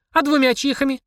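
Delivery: put-on high girlish question; little girl haggling; child's voice. A narrator's reading. Girl pressing the demon, high child voice. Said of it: "спросила Полина".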